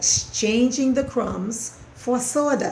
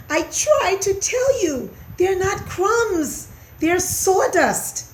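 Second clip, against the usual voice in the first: high, slow squeaky voice; little mouse protest; pleading mouse; 11 semitones higher, 3 dB louder than usual. A narrator's reading. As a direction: explain it pleadingly, drawn out.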